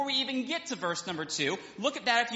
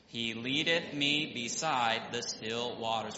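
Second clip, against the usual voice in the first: slow, low, measured reading of the psalm; slow solemn Bible reading; scripture reading; 9 semitones lower, slower than usual. Acted. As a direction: read it out slowly and solemnly, like scripture. Congregation reads Psalm aloud.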